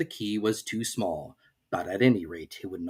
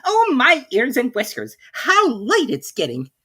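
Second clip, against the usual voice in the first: high, louder fretful rabbit voice; a flustered rabbit hurrying; 13 semitones higher, 6 dB louder than usual. A narrator's reading. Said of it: "hear it say".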